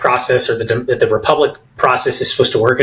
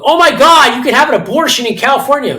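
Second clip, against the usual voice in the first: hammed-up outraged shriek, much higher and louder, rushed; mock outrage; 11 semitones higher, 4 dB louder than usual. Mocking imagined protesters with a hammed-up outraged shriek. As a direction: parody a hysterical outcry. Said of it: "freaking out going".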